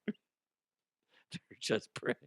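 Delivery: "They're just pricks" slow and soft through laughter; laughing repeat. Acted. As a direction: let it dissolve into a laugh.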